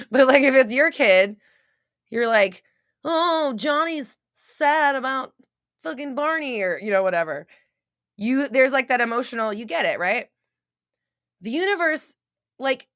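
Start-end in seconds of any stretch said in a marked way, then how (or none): 3.0-6.6 high, slow, cooing parent voice; sing-song mock sympathy, pitched high; doting parent. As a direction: coo it like a parent indulging a crying child.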